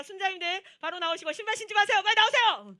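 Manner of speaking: high, very loud evacuation order; urgent shout. Retold or imitated. Announcer shouting at campers to get out fast. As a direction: push everyone out the door now.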